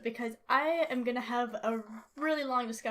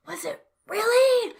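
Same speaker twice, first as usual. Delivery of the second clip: high, loud, drawn-out "really"; exaggerated skeptical cry; incredulous challenge; 9 semitones higher, 9 dB louder than usual. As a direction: question it with mock outrage. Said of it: "And it's like".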